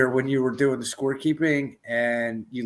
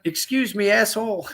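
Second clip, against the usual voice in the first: mocking echo; 8 semitones higher than usual. Mocking repeat of demand.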